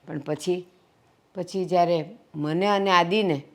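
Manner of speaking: low, subdued confession; regretful admission. Acted. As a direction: own up softly, with pauses. She admits regret to the guests. (પસ્તાવાભર્યો ધીમો અવાજ)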